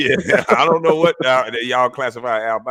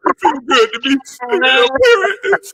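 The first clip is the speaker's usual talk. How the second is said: much higher, exaggerated mocking voice; mocking impression; 10 semitones higher, slower than usual. Mocking a TV guest's remark in a high falsetto. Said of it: "She goes".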